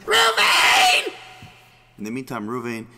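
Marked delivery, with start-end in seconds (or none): 0.1-1.1 loud, high-pitched panicked scream. Acted. Wife calls husband in panic, screaming. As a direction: scream the name for help. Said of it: "and screams to her husband".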